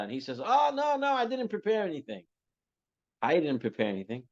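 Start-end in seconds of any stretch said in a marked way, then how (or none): 0.4-2.2 high-pitched mock protest; flustered refusal. Uncle asked to speak begs off, high flustered protest. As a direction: beg off, voice jumping up in pitch.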